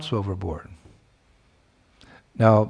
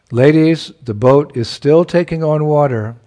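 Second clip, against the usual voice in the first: higher, louder call across the boat; 4 semitones higher, 6 dB louder than usual. A narrator's reading. Boatman warns the ladies again, calling out louder. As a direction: call it out over the wind. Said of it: "the boatman called out again".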